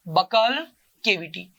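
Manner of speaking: बकल cavity drawn out slowly, each syllable clear; slow drill. Shown as a demonstration. Teacher drilling the kids on the term, slowly. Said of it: "क्या बोलते हैं बेटा जी?".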